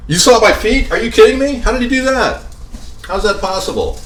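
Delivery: can't believe they saw his feet; incredulous. Real, not acted. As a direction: voice jumping up in shock. Shocked the viewers saw his feet.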